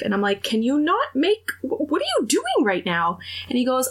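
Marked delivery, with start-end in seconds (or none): 0.4-3.2 high-pitched flustered objection; mortified protest. Retold or imitated. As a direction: cut him off in embarrassed disbelief, voice pitched up. Mortified, protests friend's matchmaking.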